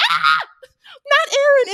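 high, loud anguished shout; retold shout. Fan shouting at the screen as Eren walks in, anguished yell. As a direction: yell it like the scene betrayed you. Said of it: "I was like".

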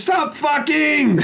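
high, drawn-out shout through a wall; retold yell. Storyteller yelling back at noisy neighbours, acted high holler. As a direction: holler it, stretching each word.

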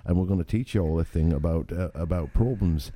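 mock-British podcast host; host the show in a put-on British accent; playful British presenter accent. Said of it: "we can only use British accents".

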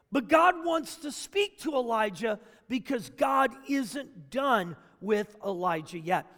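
ordinary talk

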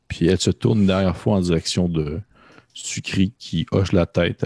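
ordinary delivery

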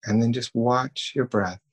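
calm, even meditation voice with long pauses; guided meditation. Acted. Leading listeners through breathing and self-thanks, calm guided meditation. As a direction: guide them gently, leaving long silences between phrases.

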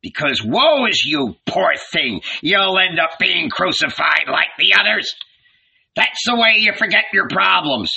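scathing telling-off; angry tirade. A narrator's reading. Furious old man berates carpenter's son.

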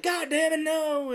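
very high, loud mock outrage; anguished yell. Host acting the man whose cake gets eaten, anguished yell. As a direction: wail it in hammed-up horror.

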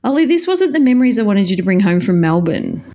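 ordinary talk

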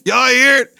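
high, loud hype call; shout. Real, not acted. Vlogger hyping his viewers with a loud shout. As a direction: call out proudly to the crowd.

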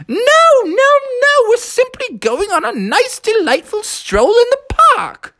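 very high, prim nanny voice; Mary Poppins. Acted. Nanny turning down Froggy's guesses, high and prim. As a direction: trill a firm, proper refusal.

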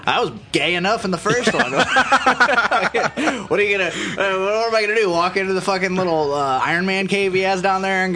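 giggling while complaining; laughing. Real, not acted. Laughing self-mocking rant.

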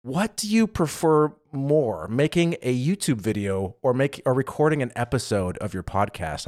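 slowed, deliberate question; slow, careful delivery. Shown as a demonstration. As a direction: take it slowly and evenly. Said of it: "It's a long way to ask that question".

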